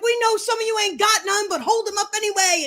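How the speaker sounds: high, bossy guard orders; imitated prison guard. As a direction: mimic a guard ordering inmates about. Imitates same guard giving orders.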